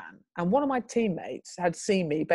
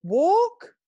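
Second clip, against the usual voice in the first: high, loud rising "walk"; unsure order shooting up in pitch; tentative command; 12 semitones higher, 6 dB louder than usual. Retold or imitated. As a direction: ask it as a question, swooping right up. Tentative command to healed man.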